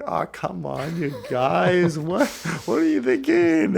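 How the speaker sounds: loud, high-pitched exasperated outburst; mock exasperation, climbing high and loud; exasperated Jesus. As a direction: scold them in hammed-up disbelief. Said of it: "was Jesus like".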